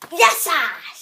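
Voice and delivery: lightly voiced bunny greeting; a hint of a toy rabbit. A girl voicing her toy bunny greeting viewers, lightly voiced. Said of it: "τη φωνή την έκανε το κουνέλι".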